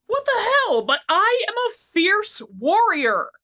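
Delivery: high, loud indignant yelp; shrill offended warrior; outraged prince. Acted. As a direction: protest like a wounded brute.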